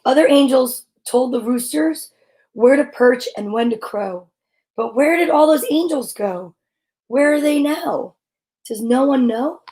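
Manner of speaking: gentle picture-book read-aloud; storybook reading. Acted. Reading a picture book about angels to listeners, gentle.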